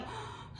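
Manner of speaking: quick breathy gasp of alarm; frightened gasp. Retold or imitated. Patient hearing surgeons' orders, frightened gasp. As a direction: suck in air as if panicking.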